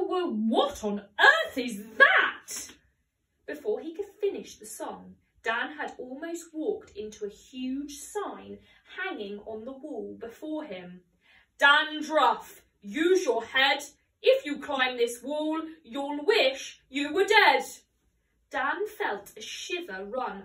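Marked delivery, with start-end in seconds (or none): 0.0-2.5 startled. A narrator's reading. Dan startled mid-song. 11.6-17.6 menacing sign. A narrator's reading. Warning sign read aloud menacingly.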